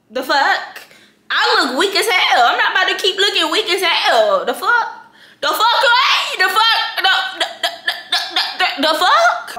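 high, loud, incredulous the fuck; hammed-up outrage, laughing through it; mock outraged yelling. Acting out her reply to the prank text, mock outraged.

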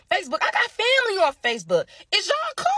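high, pointed rhetorical question to viewers; exasperated high-pitched callout; incredulous scolding. Real, not acted. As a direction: call them out, pitching up high. Scolding viewers over threats.

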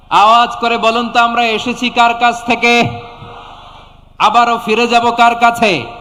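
loud, high call for an answer; loud rallying call. Real, not acted. Preacher urging the congregation to answer aloud, loud rallying call. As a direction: raise the voice to rouse the crowd.

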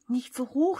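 loud, high cry of protest; shouted alarm. A narrator's reading. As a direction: call out to stop it climbing. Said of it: "schrie Pitt".

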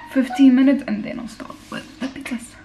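normal talking voice

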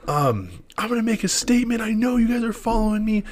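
high, rambling paranoid threat; crazed stranger. Retold or imitated. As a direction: voice him up high and unhinged. Quoting crazed man with knife.

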